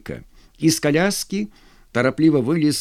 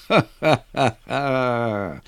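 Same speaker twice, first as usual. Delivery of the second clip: slow, rolling ha-ha laugh; acted guffaw; much slower than usual. A narrator's reading. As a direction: roar with laughter like the men. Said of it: "Ржали казаки". (раскатистый наигранный хохот)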